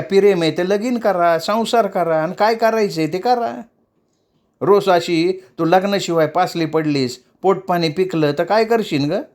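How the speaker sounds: mildly stern mother figure; stern lecturing. Acted. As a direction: lay down the law to the girl. Shevanta lectures Hausi.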